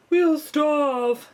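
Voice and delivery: high, loud moaning wail; moaning earthworm. A narrator's reading. Earthworm moaning to friends about hunger, loud wail. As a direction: wail it like a hungry worm. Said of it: "moaned the earthworm".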